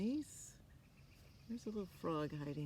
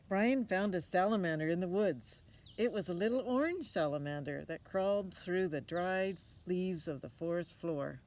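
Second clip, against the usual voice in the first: bright, louder read-aloud voice; storybook reading; 2 semitones higher, 7 dB louder than usual. A narrator's reading. Reading a picture book to a child, lively narration.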